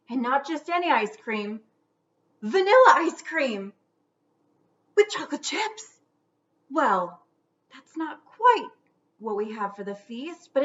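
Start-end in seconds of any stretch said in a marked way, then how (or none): 2.4-6.0 higher, louder delight; excited. A narrator's reading. Narrator thrilled as the ghost finds ice cream. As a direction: announce the treat with glee.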